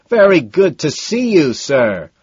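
high, loud pleasantry easing on the last word; hollered courtesy; shouted greeting. A narrator's reading. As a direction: shout a polite hello over music. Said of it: "shouts Madison".

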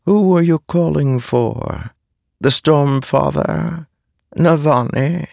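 high-pitched, taunting questioner; Taravangian. A narrator's reading. A vast shadow questions fallen Dalinar, mocking. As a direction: probe with each name, pitched high. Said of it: "with Taravangian's voice".

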